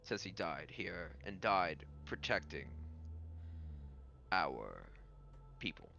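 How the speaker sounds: very halting, grave speech with long pauses; solemn lord character. Acted. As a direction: honor the dead, a few words at a time. Game master voices the lord.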